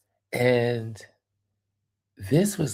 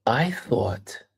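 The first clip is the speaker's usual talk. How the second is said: ordinary talk, nothing marked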